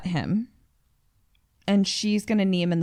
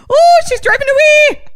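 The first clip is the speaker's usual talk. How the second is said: high, loud leprechaun squeak; 10 dB louder than usual. Shown as a demonstration. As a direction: chirp it like a little leprechaun. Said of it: "a little leprechaun".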